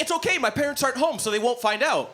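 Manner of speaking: put-on high, quick child voice; high-voiced kid coaxing an adult; kid on the street. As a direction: pitch it up like a scheming little kid. Voices kid reassuring him.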